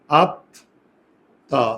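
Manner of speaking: আত্মা said as "আত্তা", the ত held long and doubled; doubled consonant. Shown as a demonstration. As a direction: stretch the double ত in আত্মা very slowly. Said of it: "তাহলে দেখো উচ্চারণটা কি হয়". (আত্মা উচ্চারণে ত দুবার, টেনে টেনে)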